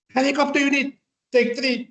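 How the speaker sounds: generous airman. Retold or imitated. Airman offers help, high.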